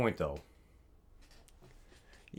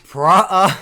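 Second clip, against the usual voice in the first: loud attempt collapsing into laughs; breaking into laughter; 6 semitones higher, 14 dB louder than usual. Real, not acted. Host botching his segment shout, breaking into laughter. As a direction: start big, then crack up. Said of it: "I started laughing".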